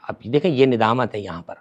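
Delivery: ordinary delivery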